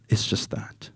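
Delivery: soft closing whisper. Real, not acted. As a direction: drop to a whisper. Narrator closes point.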